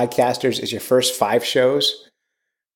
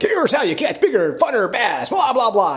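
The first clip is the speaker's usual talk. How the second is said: high, far-off mock pitchman voice; a jokey put-on voice parodying bass fishing show hosts; 10 semitones higher than usual. Podcast host parodying other fishing shows, high mock pitchman voice. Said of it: "they're all about, like".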